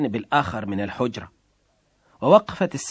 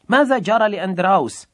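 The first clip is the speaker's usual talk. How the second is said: sharp, louder and higher demand down the phone; 7 semitones higher, 5 dB louder than usual. Acted. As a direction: snap the question at the wife. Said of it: "قال في حدة".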